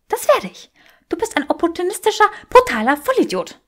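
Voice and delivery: furious woman calling him names; insulting Granger. Acted. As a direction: spit the insults out quickly.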